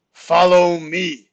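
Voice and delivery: loud, slow otherworldly command; big, drawn-out summons. A narrator's reading. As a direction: boom it out slowly, as if another being speaks. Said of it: "but in a deeper voice that did not belong to him".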